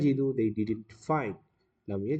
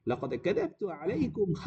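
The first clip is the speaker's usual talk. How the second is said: shepherd's gloating; 7 semitones higher, faster than usual. Acted. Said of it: "He started laughing".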